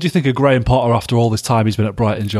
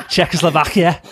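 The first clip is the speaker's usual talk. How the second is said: put-on Czechoslovakia said in a higher Liverpool Scouse accent; 5 semitones higher than usual. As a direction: imitate a Scouser saying Czechoslovakia. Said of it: "hear someone say Czechoslovakia in a S-, Scouse accent".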